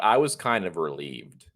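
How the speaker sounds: usual speaking voice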